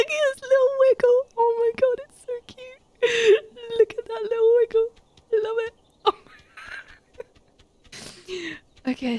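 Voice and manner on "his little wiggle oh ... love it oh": high-pitched cooing over something cute; delighted squeal. Real, not acted. Player watching the goose wiggle, squealing at how cute.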